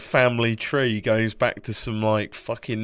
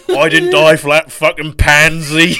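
mock grandpa; 6 semitones higher, 15 dB louder, slower than usual. Voicing grandpa rolling in grave.

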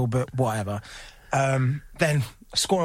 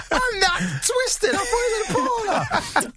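high and slow, giggling through every word; laughing; slower than usual. Real, not acted. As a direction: repeat it helpless with laughter. Laughing at his own mistake.

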